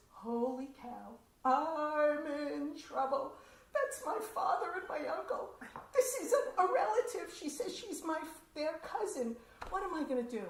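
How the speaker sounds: a girl realising she's in trouble, hammed up; mock panic. Girl realises the cousins are her father's, mock panic with laughter.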